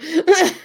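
quick high laugh; short laugh. Real, not acted. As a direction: laugh at the joke. Laughs at own joke.

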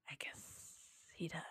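breathed out, defeated and drained; barely voiced, too worn out to fight; exhausted whisper. Worn-out mom giving up on her son, defeated whisper. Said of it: "I was already so broken".

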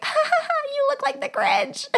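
squeaky-high mock cackle through the words; teasing laughter. Girl teasing her boyfriend to lighten the mood, high giggly teasing. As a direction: laugh it out high, poking fun.

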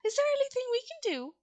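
high, quick mouse offer. A narrator's reading. Big mouse offers help to the Woodman, quick high mouse voice. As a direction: ask briskly in a small high voice. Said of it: "one of the biggest mice spoke".

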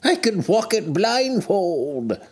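put-on high, eager old miser; fervent Scrooge. A narrator's reading. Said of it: "cried Scrooge with fervor".

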